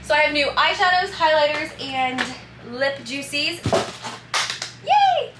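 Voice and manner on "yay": high, loud squeal of joy; excited squeal. Real, not acted. Vlogger cheers over her PR haul, high loud squeal. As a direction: squeal it out with delight. Showing off new products.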